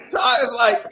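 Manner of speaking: usual speaking voice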